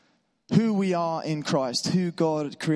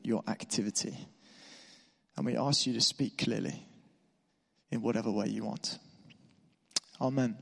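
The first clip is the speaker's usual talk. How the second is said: hushed congregational prayer. Real, not acted. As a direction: pray it gently and sincerely. Leads a prayer.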